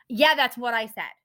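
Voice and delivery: imitating rude snap. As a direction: throw it back like an annoyed clerk. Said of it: "she was so rude to me".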